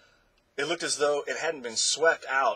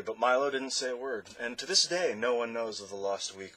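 usual speaking voice

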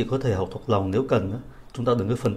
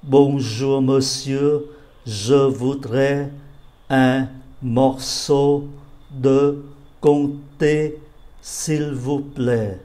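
slow, clear model reading; teacher rereads the sentence slowly; slow read-aloud; much slower than usual. Shown as a demonstration. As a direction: read the request slowly, word by word. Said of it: "Đọc lại".

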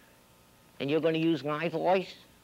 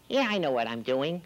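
ordinary talk, nothing marked

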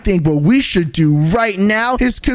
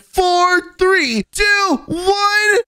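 excited countdown; 10 semitones higher, much slower than usual. Acted. Countdown to Huggy Wuggy spawning.

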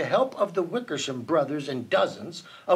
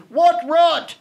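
loud, high scornful shout; 10 semitones higher, 9 dB louder than usual. A narrator's reading. Jungle monkeys burst in on Horton, shouting. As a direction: shout it out in outrage, pitched right up. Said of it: "came shouting".

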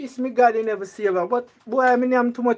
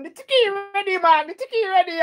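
put-on Chinese accent, pitched much higher; mock Chinese voice; 11 semitones higher than usual. Imitating the Chinese shopkeeper on the phone in a mock Chinese accent. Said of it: "change me voice".

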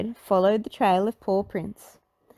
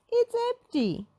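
high dismayed whine; shocked and sad; Shaggy voice. A narrator's reading. Shaggy staring at the empty oven, high and dismayed. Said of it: "cried Shaggy".

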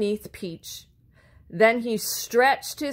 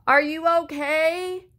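high calling question; yelled from afar; 8 semitones higher than usual. A narrator's reading. Blue shouts down the hill to Peach, high call. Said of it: "yelled Blue from high up the hill".

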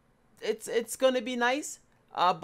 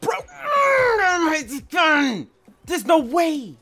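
loud, slow, high excited yell; excited shout; 9 semitones higher, 7 dB louder, slower than usual. Real, not acted. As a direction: shout in disbelief. Excited at gun in game.

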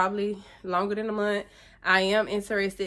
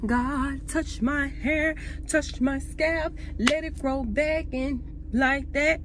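higher, slow, pleading prayer to God; 6 semitones higher, slower than usual. Retold or imitated. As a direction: beg God for your hair back, drawn out. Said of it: "But I'm praying".

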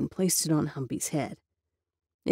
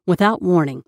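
ordinary delivery

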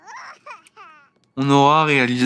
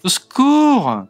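panicked cry for help; 10 semitones higher, much slower than usual. Pretend. Player voicing his sim fleeing the ghost, high panicked yelp.

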